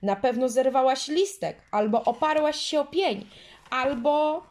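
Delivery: put-on angry girl, high and loud; angry accusing. A narrator's reading. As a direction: pile up the blame. Girls quarrelling, anger continues.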